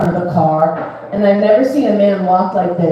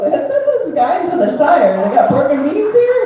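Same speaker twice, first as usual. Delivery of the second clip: mock hobbit voice; 9 semitones higher than usual.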